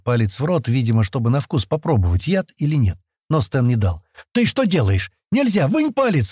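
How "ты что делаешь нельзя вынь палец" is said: high-pitched panicked scolding, climbing; alarmed yelling. A narrator's reading. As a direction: cry out to stop a child in danger. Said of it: "закричал он". (встревоженный крик, всё выше)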